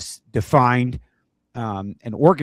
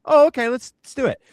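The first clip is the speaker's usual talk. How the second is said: eager agreement; 11 semitones higher, 7 dB louder than usual. Retold or imitated. Colleague agreeing eagerly, imitated.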